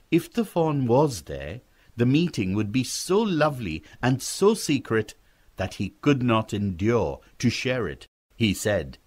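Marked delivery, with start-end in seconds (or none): none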